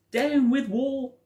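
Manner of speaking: hooted high and loud, slowly drawn out. A narrator's reading. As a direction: hoot each word slowly. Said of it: "he hooted".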